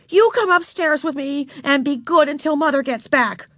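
high, bossy big-sister command; stern order. A narrator's reading. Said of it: "she ordered".

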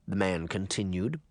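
plain reading voice

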